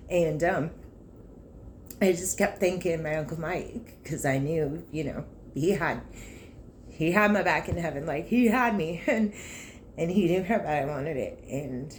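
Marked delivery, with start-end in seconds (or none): none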